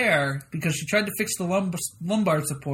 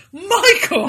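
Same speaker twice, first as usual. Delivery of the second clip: much higher, louder mock scold; scolding shout; 13 semitones higher, 7 dB louder than usual. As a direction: call out his name in shock. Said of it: "Holly are like".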